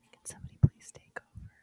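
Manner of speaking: fast whispered read. Real, not acted. Player rereading the clue under her breath in a whisper. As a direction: whisper it to yourself quickly.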